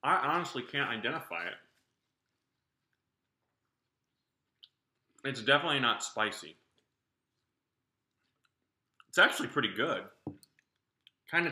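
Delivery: talking while chewing candy; slightly muffled chewy speech; mouth full, chewing. Real, not acted. Seen 0: Bit the sweet at -7; mouth moves as if chewing while talking.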